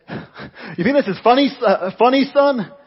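loud, high American border officer; put-on American tough-guy threat; American accent. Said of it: "That's my American accent, sorry, it's not very good".

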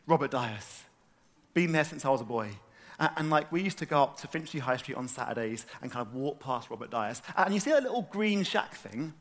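normal talking voice

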